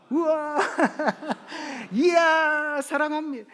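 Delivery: high, loud, beaming cry of love; excited shout of love. Shown as a demonstration. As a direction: burst out with joy like a demo. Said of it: "제가 그거 하시라고 그랬더니". (높고 크게 외치는 사랑 고백)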